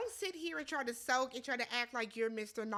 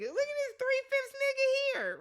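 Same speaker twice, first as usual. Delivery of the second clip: pitched up, incredulous; high sassy quote; 12 semitones higher, 5 dB louder than usual. Retold or imitated. Retelling her reaction to Steven, high incredulous voice. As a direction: say it high, like you can't believe him. Retold remark, corrected and repeated.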